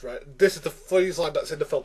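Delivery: ordinary talk, nothing marked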